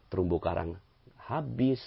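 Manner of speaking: ordinary delivery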